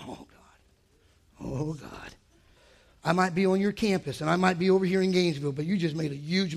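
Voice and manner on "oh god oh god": hushed, stricken whisper; whispered dismay. Retold or imitated. Father hearing the doctors' advice, a stricken whisper. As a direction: breathe out horror.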